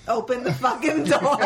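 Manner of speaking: a hint of a little shithead, laughing; bratty mock demand. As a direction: sneer it like a brat. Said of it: "Like a little shithead".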